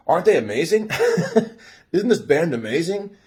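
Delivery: high, bubbly question with a laugh in it; laughing opener. Retold or imitated. To the cute girl at the concert, a laughing excited question. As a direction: giggle through it like an eager fan.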